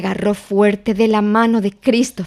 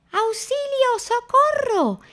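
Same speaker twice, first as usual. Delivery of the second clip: terrified shout; slower than usual. A narrator's reading. Piglet fleeing the heffalump, terrified squeal. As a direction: scream it, fleeing a monster. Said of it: "gritó Porquet".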